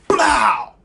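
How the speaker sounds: loud, high punch on the word; explosive shout. Retold or imitated. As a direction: hit it like the smash. Smashing the bottle.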